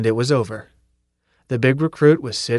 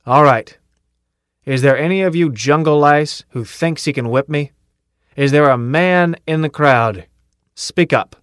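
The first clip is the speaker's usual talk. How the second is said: loud, hard-driving sergeant challenging the crowd; a tough sergeant daring anyone to fight him; drill sergeant bellow; 3 semitones higher, 5 dB louder than usual. Acted. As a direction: bark the dare at the whole line.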